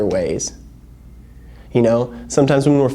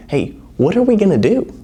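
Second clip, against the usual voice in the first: Jesus asking; 7 semitones higher than usual. Retold or imitated. Jesus voiced asking a question.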